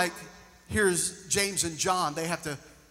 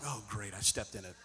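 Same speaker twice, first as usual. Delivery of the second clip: low, much softer rueful mutter; wry aside; 8 semitones lower, 11 dB quieter than usual. Retold or imitated. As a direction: mutter it as a self-deprecating joke. Wry self-reproach aside.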